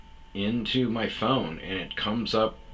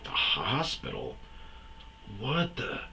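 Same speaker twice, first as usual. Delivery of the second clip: a hint of retold disbelief, pitched up at the end; baffled disbelief; 5 dB quieter, slower than usual. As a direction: lightly act out being baffled by the directions. Baffled on hearing directions.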